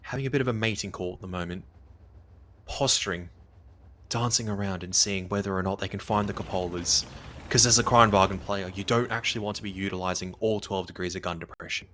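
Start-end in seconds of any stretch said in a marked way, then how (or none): 0.0-7.1 lower, hushed nature documentary narrator; David Attenborough voice. Retold or imitated. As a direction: narrate it like a wildlife film, low and hushed. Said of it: "I thought you were gonna go into a David Attenborough kind of, like, impression".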